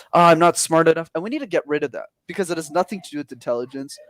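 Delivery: ordinary talk, nothing marked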